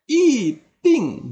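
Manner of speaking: "i ting" both falling sharply from high, loud; wrong fourth-tone reading. Shown as a demonstration. Teacher showing another mistake, "i ting" with two loud falls. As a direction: punch "i" and "ting" each downward. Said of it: "atau bukan".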